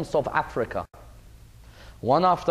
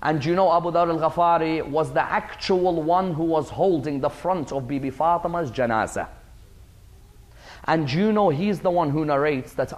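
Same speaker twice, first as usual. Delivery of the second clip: usual speaking voice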